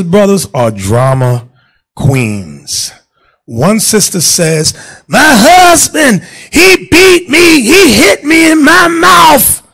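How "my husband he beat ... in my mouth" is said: high, slow, whiny complaining wife voice; whining wife. Retold or imitated. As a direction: play the wife crying victim, drawn out. Preacher acts complaining wife.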